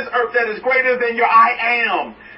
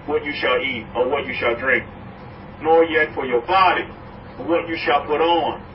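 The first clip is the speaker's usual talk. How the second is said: solemn scripture reading; 5 semitones lower than usual. Acted. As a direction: read the verse low and solemn. Reading scripture lower, slower.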